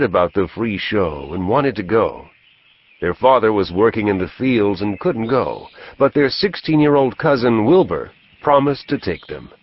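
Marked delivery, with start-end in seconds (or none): none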